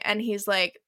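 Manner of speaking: ordinary delivery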